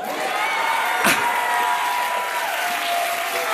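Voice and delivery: high airy burst of laughter; delighted laugh. Real, not acted. Boy hearing he won, bursting into high laughter. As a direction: laugh out in gleeful disbelief. Reaction to winning.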